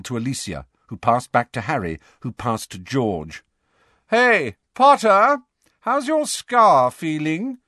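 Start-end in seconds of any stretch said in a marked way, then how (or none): 4.1-7.6 high, slow, mocking taunt in a put-on voice; sneering Malfoy. Malfoy taunting Harry from below, slow high sneer. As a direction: drag out the jeer to needle him. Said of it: "called Malfoy".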